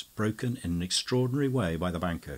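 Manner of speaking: ordinary delivery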